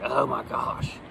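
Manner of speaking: soft, low face-palm sigh, acted; mock dismay under the breath; exasperated. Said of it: "he's face palming like".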